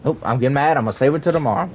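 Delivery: usual speaking voice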